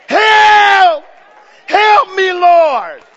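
high, very loud long shout; desperate cry for help. Acted. Preacher enacting Jehoshaphat crying out to God, screaming for help. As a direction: scream it out, stretching the word. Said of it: "That's how Jehoshaphat cried out".

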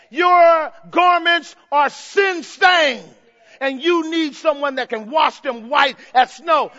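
loud, high-pitched pulpit cry; preaching at full force. Acted. Preacher calling sinners to come, full-force preaching. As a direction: thunder it out to the congregation. Preacher voicing God's invitation.

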